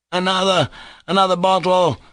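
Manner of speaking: high, calling to the innkeeper. Acted. Mitya calling the innkeeper for another bottle, loud and high. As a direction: shout the order across the room. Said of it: "Mitya cried".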